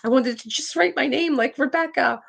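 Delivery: high, eager gush about a friend; playful mock admiration; mock excited gushing. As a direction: gush like a starstruck fan. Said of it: "I'm like".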